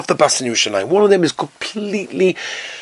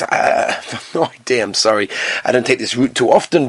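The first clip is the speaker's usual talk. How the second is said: calm, unbothered passenger; 7 semitones lower than usual. Acted. Calm passenger brushes off worry.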